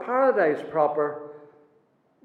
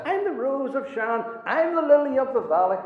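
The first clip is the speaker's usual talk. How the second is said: mildly mocking self-praise, higher; mocking boast; 8 semitones higher than usual. Preacher mocking a man who praises himself, higher voice. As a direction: lightly send up a bragging man. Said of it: "how can anybody speak about this himself like this".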